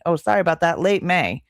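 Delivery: ordinary talk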